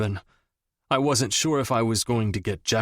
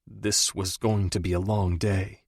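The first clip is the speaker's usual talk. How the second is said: ordinary delivery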